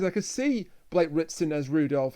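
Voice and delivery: ordinary talk, nothing marked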